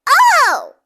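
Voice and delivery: a high, startled little squeal from Chicken Little. A narrator's reading. A hen hit by an apple, high startled squeal. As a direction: squeak out the fright, childlike and high. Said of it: "squealed Chicken Little".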